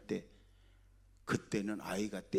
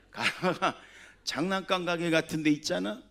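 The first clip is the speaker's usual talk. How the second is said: laughing; 6 semitones higher, 6 dB louder than usual. Real, not acted. Laughing while telling a funny story.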